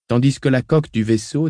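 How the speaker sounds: ordinary delivery, nothing marked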